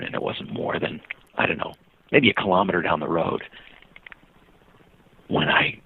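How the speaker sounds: usual speaking voice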